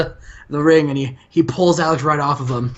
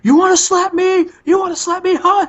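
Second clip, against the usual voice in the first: much higher, louder, squealing Flair taunt; Ric Flair; 4 dB louder than usual. Retold or imitated. Slapped Ric Flair daring Alex to do it again, high mock taunt. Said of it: "Ric Flair is like".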